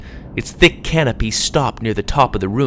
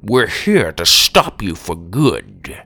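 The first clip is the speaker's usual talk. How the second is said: firm determined grandpa; 5 semitones lower, slower than usual. Acted. Grandpa confronting villain.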